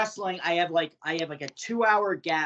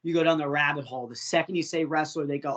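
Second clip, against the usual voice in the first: ordinary talk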